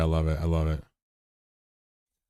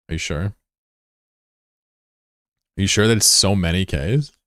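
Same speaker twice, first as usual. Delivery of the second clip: ordinary delivery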